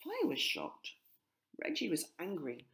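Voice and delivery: usual speaking voice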